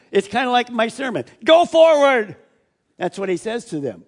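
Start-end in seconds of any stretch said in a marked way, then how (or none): 1.4-2.4 much louder, higher order; urgent command. Acted. Angel commanding the disciples, loud and high. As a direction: push them forward with a shout.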